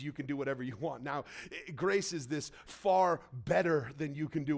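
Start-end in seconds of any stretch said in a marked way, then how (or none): 0.0-1.0 softer, fast throwaway; quick dismissive aside. Retold or imitated. Preacher brushing off the wrong idea, quick soft aside. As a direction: toss it off quickly and quietly. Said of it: "that's not what grace is".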